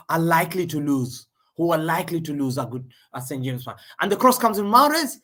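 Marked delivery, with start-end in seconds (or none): 4.0-5.2 high, loud shout of the name; sudden excited call. Real, not acted. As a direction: yell the player's name as the cross lands. Cross comes in, live reaction.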